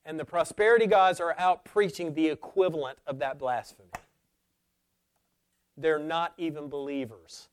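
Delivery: loud, emphatic pulpit denunciation; forceful preacher's outcry; thundering preaching. Real, not acted. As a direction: thunder it at the congregation, leaning on the key words.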